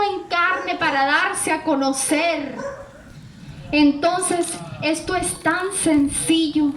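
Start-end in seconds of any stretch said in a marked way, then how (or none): none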